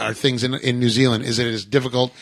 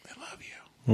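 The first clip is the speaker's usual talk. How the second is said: whisper; 11 dB quieter, slower than usual. Real, not acted. Repeats affection to friend Jake.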